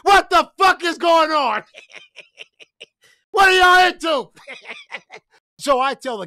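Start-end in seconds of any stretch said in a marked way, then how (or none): 0.0-1.6 loud, high and slow in disbelief; real stunned outburst; shocked disbelief. Real, not acted. Shocked by the wrestling talk. 3.3-4.3 loud, high shout of disbelief; real disbelieving yell; incredulous shout. Real, not acted.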